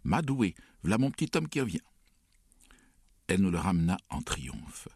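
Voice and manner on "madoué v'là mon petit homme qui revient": high, overjoyed calling out; excited cry. A narrator's reading. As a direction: cry out with joy at his return. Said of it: "elle s'élança en criant". (cri de joie aigu)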